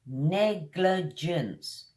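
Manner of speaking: Negligence drawn out slowly, falling; slow model word. Shown as a demonstration. Teacher modelling the word slowly for repetition. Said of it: "Kind of an unusual sound".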